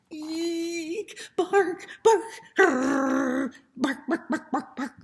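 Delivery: be a frightened puppy sounding the alarm; scared puppy. Acted. Scared puppy barks and growls.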